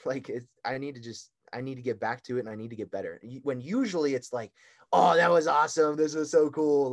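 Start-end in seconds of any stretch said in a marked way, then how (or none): 4.9-6.9 louder, bubbly imitation of herself; lightly hammed-up delight; mock excited gush. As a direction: gush like after a good meet. Imitating her usual post-meet mood.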